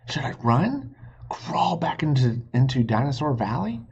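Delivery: frantic whispered questions; panicked whisper. Acted. Jack hides from the dinosaur, whispering in panic. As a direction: hiss the options in a panic.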